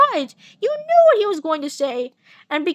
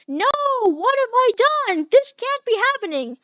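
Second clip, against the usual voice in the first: higher panicked gasp; horrified; 7 semitones higher than usual. Acted. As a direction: recoil in horror. Horrified at the villain.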